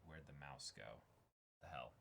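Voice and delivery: usual speaking voice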